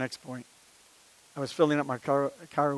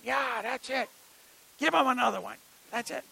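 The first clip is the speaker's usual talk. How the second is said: cheering; 8 semitones higher than usual. Retold or imitated. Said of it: "Boy, was I cheering".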